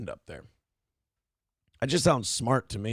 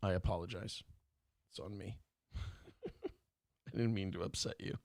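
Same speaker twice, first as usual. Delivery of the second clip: much softer, hushed and lower; mock apology; 5 semitones lower, 15 dB quieter than usual. Host makes a mock-sheepish apology to Chad, hushed. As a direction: whisper the apology with a sly laugh.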